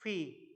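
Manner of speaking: "फी" with a breathy f-like onset; "फी" in place of a plain pee; American-style P. Shown as a demonstration. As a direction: say P as "फी", falling pitch. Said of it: "तो इसको हम pronounce कर सकते हैं".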